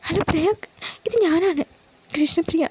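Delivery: put-on high, anxious young woman denying it; pleading Priya. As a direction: plead innocence, high and worried. Priya phones Prayag. (ഉയർന്ന, വേവലാതിയോടെയുള്ള നിഷേധം)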